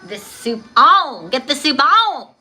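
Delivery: loud, drawn-out call to get the soup on; excited. Real, not acted. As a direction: belt it out happily.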